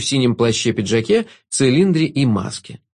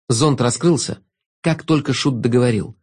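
ordinary delivery

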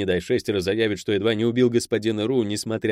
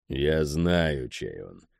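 low, sullen cutoff; gloomy; 6 semitones lower, 4 dB quieter, much slower than usual. A narrator's reading. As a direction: answer darkly, dropping low and soft. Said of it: "хмуро ответил Борг".

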